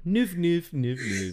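high goofy noof noises; silly mispronunciation. Retold or imitated. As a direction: mimic the mangled name playfully. Mimicking mispronounced name.

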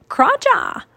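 high, loud squawked bird talk; raven croak. A narrator's reading. Teddy speaking raven to Jack and Annie, croaking. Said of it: "Teddy croaked".

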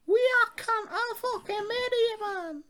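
squeaky, very high mock Cockney; London accent. Shown as a demonstration. Host escalating the threat bit, absurdly high London accent. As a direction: take the London accent up into falsetto. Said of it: "Give us your best London accent".

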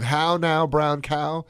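"How now, brown cow" sung out high and loud; "How now, brown cow" bright and lifted; elocution drill. Shown as a demonstration. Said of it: "instead of".

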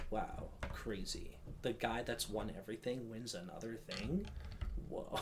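deadpan quiet sarcasm; dry, unimpressed mock awe; sarcastic. Real, not acted. Sarcasm about Nolan winning.

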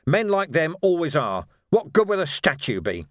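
high, loud, indignant tirade; outraged. Acted. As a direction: explode with righteous fury. Outraged retort to memorial idea.

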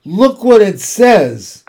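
high, loud exclamations; excited cry. A narrator's reading. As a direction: cry out the discovery, drawing out the end. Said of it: "cried Fern".

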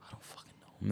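hushed under-breath whisper. Real, not acted. Whispering in frustration while arguing with his friend. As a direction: drop to a breathy whisper, fed up. Exasperated mutter in argument.

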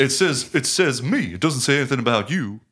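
high, loud and boastful, crowing over the flyer; proud Joe Buck. Retold or imitated. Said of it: "Joe Buck's like".

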